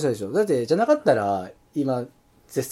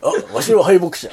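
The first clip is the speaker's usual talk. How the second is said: laughing; 4 semitones higher, 5 dB louder, slower than usual. Acted. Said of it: "エースの敗北者の話".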